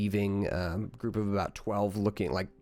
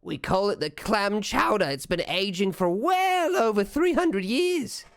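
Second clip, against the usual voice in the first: goblin waitress voice; 9 semitones higher than usual. Acted. Waitress describing the scotch.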